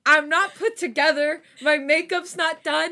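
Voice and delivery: high, loud, flustered panic; stressed rant. Real, not acted. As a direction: vent in a stressed rush. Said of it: "I'm genuinely stressed right now".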